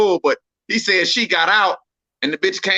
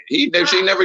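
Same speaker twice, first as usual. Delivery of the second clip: ordinary talk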